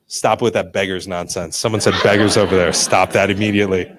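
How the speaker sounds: very low, deadpan reprimand; low mock-disapproving warning; mock stern. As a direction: shut it down in a low voice. Mock scolding of rival pizza choice.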